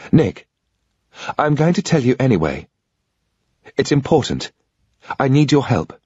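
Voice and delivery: a lightly voiced woman, urgent and earnest; a hint of a pleading woman's voice; Sarah, pleading.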